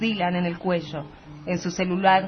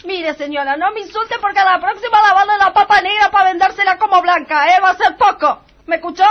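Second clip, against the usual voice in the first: high, loud, fast threatening greengrocer; high angry Teresa; 9 semitones higher, 6 dB louder than usual. Acted. Teresa threatens the insulting wife, high and loud. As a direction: pitch up and scold the wife hard.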